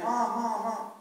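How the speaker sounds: high, loud calls of Mom; shouting for mom. Retold or imitated. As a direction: call out for mom across the house like an excited boy. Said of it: "shouting her name".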